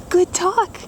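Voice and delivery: high praising voice for a dog. Real, not acted. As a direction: pat the dog with your voice. Praising dog after talk.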